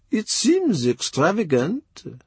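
high, slow, sly murmur from the ambassador; the ambassador murmuring a sly reply to the king; murmured envoy voice. A narrator's reading. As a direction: let it out slowly, a polite understatement. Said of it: "Chapuis murmurs".

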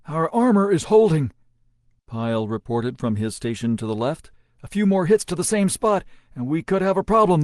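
loud, high report under fire; loud battle report. A narrator's reading. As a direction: shout the damage report. Pyle reports damage.

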